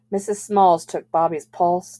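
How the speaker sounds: plain reading voice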